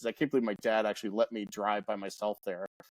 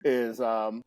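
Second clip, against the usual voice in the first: ordinary delivery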